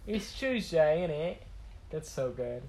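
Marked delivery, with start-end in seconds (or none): 0.1-1.4 slow British "Chewsday, innit"; mock British accent. Echoing co-host's joke, drawn-out mock British accent. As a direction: draw out a British Tuesday. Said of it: "British people would be like".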